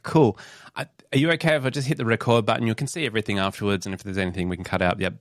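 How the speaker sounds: quick breezy patter; fast. Retold or imitated. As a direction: rattle it off fast. Quoting her own fast patter.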